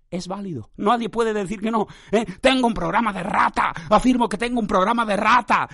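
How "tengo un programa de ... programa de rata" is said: higher, emphatic declaration; mock defiant proclamation. Podcaster affirming his rat programme to doubters, mock defiant. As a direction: proclaim it proudly like an official statement.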